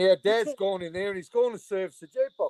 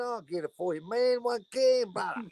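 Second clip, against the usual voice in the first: begging sing-song, pitched up; higher wheedling voice; 5 semitones higher than usual. Retold or imitated. Woman at the pub begs a can off the young man, wheedling. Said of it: "She going".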